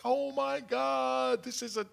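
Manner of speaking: high, drawn-out mock gasp of wonder; awestruck kids. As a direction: imitate children marvelling, high and slow. Acting out delighted kids' reaction.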